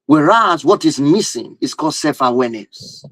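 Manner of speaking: ordinary talk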